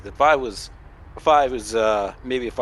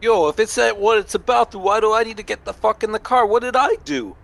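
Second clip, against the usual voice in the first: higher, fast indignant voice; protesting; 9 semitones higher than usual. Pretend. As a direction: demand answers. Character protests arrest.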